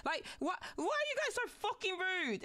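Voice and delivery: high, outraged disbelief; exasperated outburst. Real, not acted. Streamer ranting at rude viewers, outraged. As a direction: blast them for being rude.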